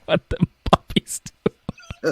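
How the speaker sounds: slow, breathless laughing words. Real, not acted. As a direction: laugh through the question.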